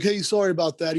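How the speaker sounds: a hint of a breezy dog owner; lightly voiced dog owner. Voicing the dog's owner.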